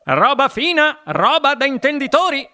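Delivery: high, relishing boast; exclaiming. A narrator's reading. Wonka boasting of his chocolates, high excited exclamation. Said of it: "esclamò il signor Wonka".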